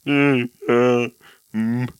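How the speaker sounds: deadpan one-word mumbles, long gaps between; mumbling brother impression. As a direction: mimic his curt, mumbly replies. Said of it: "hur hon härmade dig på Volvo".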